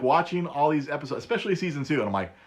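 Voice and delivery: ordinary delivery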